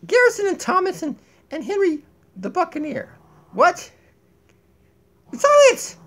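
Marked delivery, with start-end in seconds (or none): none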